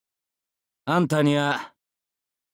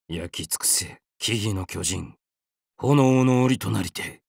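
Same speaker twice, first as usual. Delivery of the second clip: low incantation; 6 semitones lower, 3 dB quieter than usual. Acted. Spell incantation.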